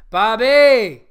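big, high, loud holler of the name; exuberant shout. Real, not acted. Host hailing the listener who wrote in, exuberant shout. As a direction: belt out the name, pitch swooping up then down. Host hails the letter writer.

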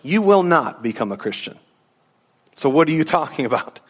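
ordinary delivery

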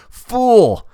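loud, high cry of contempt; scornful shout. Acted. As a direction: hurl the insult out loudly. Dante scolds the unbeliever.

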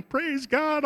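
retold praise, bursting out; joyful praise. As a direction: shout thanks to God.

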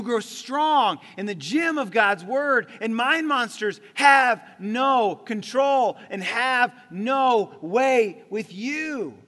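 loud, slow, shouted declaration; preaching climax. Real, not acted. Pastor shouts the climax at the congregation, slow and loud. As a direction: drive it home with force.

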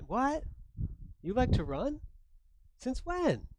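high, rising cries of disbelief; shocked disbelief. Retold or imitated. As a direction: gasp it out as if the news is brand new. Husband's shocked questions.

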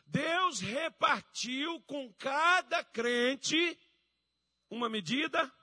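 high, loud, very slow proclamation; preaching. Acted. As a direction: declare it to the whole church, phrase by phrase. Preaching at full force.

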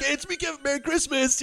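mock Christmas greeting. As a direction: coo it like a creepy holiday video. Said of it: "going like".

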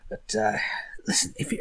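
whisper. Acted. Continued hushed aside.

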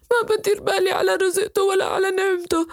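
put-on a little boy sobbing that his father threw him out; crying boy. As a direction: cry out the words through tears. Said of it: "وعم ببكي".

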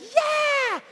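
very high, loud triumphant "yeah"; victory yell. Retold or imitated. Spelling bee winner celebrating, victory yell. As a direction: shout like you just won.

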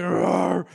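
long, loud growled roar; lion roar impression; imitated lion roar. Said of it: "He just looked at me".

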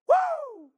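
loud, high preacher's "woo"; piercing whoop of praise; exultant whoop. Real, not acted. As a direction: let out a triumphant high holler. Exultant whoop after proclamation.

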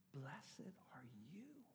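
slow, hushed scripture reading; Jesus' words whispered to the mourning; reverent whisper. Acted. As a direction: let the words fall softly.

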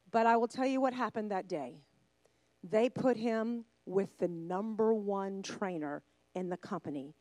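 ordinary delivery

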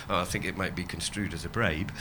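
a hammed-up, half-hearted impression of a polite policeman; terrible Peter Sellers accent. Police officer refusing tea and cake, a bad lightly voiced comic accent. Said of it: "Please forgive that terrible accent. He, he was actually Peter Sellers".

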